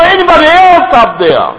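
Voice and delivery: high, loud call upward, asking for someone else; hammed-up shout upward for someone else; cheeky shout. Preacher repeats the punchline, the man calling upward. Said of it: "他说".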